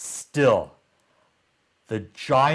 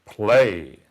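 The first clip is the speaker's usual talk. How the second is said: booming giant command; giant's command; 2 semitones lower, 7 dB louder than usual. Acted. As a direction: bark it like a giant ordering a servant. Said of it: "and said".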